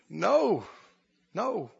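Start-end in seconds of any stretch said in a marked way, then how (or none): none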